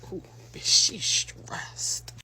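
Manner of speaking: soft gossipy whisper. Real, not acted. Narrator whispers to her mother about the stylist. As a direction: whisper it so she can't hear.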